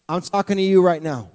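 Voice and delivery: usual speaking voice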